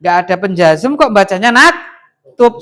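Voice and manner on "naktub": "naktub" cut off on the b, no final vowel; wrong vowelless ending. Shown as a demonstration. Teacher shows a faulty reading, final vowel missing. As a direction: close "naktub" on the b.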